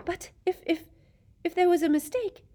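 put-on high, hesitant girl; timid girl pleading; young girl voice. A narrator's reading. Hermione voiced, timid plea.

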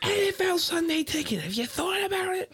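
hammed-up screeching pitch to a TV company; John Taffer yelling. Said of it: "You sound like, uh, the parrot from".

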